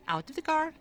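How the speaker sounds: loud, high call to the dog. Real, not acted. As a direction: order her out, high and loud. Ordering dog out of car.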